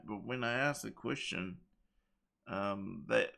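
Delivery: ordinary talk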